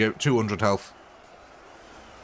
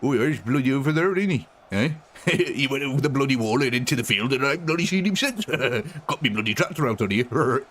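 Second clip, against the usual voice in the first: put-on Yorkshire accent. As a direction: grumble like a Yorkshire farmer. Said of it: "I love the Yorkshire accent".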